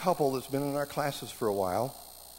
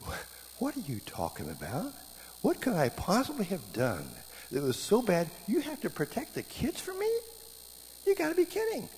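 climbing much higher in mock disbelief; incredulous disbelief; 6 semitones higher than usual. Husband hearing his wife's rebuke, rising incredulous. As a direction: act stunned, can't believe it. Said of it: "I remember thinking".

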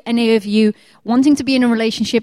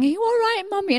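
small son; 8 semitones higher, 5 dB quieter, faster than usual. Retold or imitated. Son worried about crying mum.

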